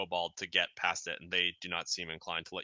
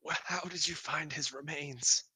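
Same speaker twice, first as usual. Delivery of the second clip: a touch higher, plaintive; lightly voiced grieving parent; 5 semitones higher than usual. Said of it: "he's, like, weeping".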